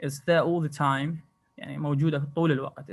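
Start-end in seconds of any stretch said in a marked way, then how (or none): 0.1-1.1 slow, careful "It's there all the time"; slow demo sentence. Shown as a demonstration. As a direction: say it slowly for the class. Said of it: "مثل كلمة all". (جملة مثال ببطء)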